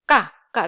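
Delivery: hard glottal start on "Ga"; tense k. Shown as a demonstration. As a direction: hold the air, then pop "ga". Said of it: "that is a sound".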